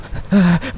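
high, crazed laugh; possessed woman's laugh; mad laugh. A narrator's reading. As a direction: break into a deranged high cackle.

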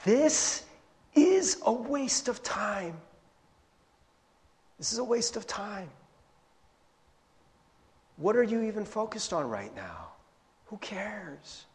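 this is pointless, let it go; quiet, weary brush-off; dismissive Jesus. Acted. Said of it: "let me paraphrase for you".

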